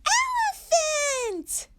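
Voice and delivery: yelled. Acted. Little Owl yells his elephant guess at Mommy. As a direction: burst out with delight. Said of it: "yelled Little Owl".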